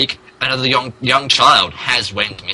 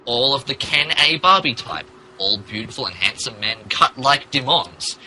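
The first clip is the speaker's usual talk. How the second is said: ordinary talk, nothing marked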